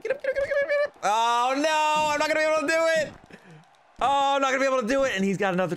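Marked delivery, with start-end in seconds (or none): 0.0-1.0 high frantic pleading; raging gamer. Real, not acted. Pleading with his wrestler onscreen. 1.0-5.1 fast panicked rant; raging gamer. Real, not acted. Panicking during the match.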